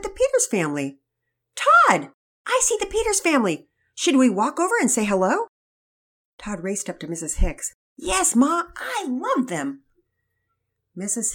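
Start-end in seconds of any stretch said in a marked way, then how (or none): 1.5-5.5 high, loud call across the park; mother spotting friends, calling out; excited call. Acted. Mother calls out to son. 8.0-9.8 high, slow adoring yes; eager boy. Acted. Todd voiced in read story.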